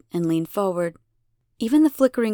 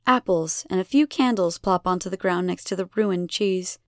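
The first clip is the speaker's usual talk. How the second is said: ordinary delivery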